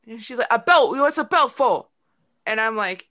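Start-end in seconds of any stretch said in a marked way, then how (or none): 0.5-1.9 higher, louder mock yell of a British child; exasperated child's yell, mimicked; imitated screaming. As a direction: insist loudly, imitating a little British girl. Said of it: "she's sort of screaming it at me".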